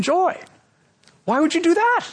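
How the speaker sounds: high-pitched rhetorical questioning; incredulous challenge. Real, not acted. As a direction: question them in disbelief, pitch leaping up. Preacher challenges congregation with rhetorical question.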